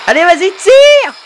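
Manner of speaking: root hard for the winning shot; shouted high encouragement; excited cheering. Real, not acted. Cheering the final blow.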